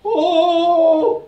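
mild elephant trumpet, loud; trumpeting elephant cry. Acted. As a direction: blast it like a trumpet. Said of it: "he trumpeted out".

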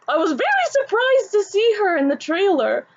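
gush, still buzzing from the news; excited gush. Real, not acted. Fan telling viewers about the casting, high and excited. Still thrilled after the squeal.

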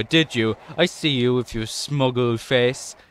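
normal talking voice

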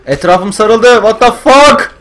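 loud, high alarmed outburst; panicked. Real, not acted. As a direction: shout in disbelief as they surround you. Surrounded by zombies, panicking. (panikle bağırarak)

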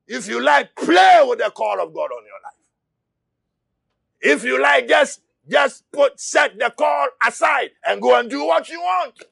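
shouted sermon, driving hard; impassioned preacher; fiery preaching. Acted. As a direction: preach it at full force to the church. Preaching at full force.

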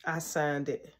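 low, quiet admission; hushed, reluctant owning-up; meek confession. Retold or imitated. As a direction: own up in a small, low voice. Child confessing to angry mother.

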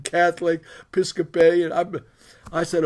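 ordinary talk

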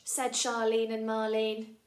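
ordinary delivery

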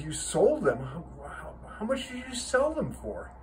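stammering disbelief, rising high at the end; an astonished dad demanding an explanation; taken aback. Acted. Father learns gear was sold.

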